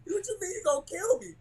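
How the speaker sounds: high-pitched startled question; mock disbelief. Freshman answering a rival's death threat, high and shocked.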